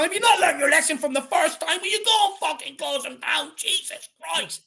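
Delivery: high, loud furious rant at the player; angry shout. Real, not acted. Angry fan yelling at Sessegnon to close them down, furious shout. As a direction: bawl at him as if he could hear you.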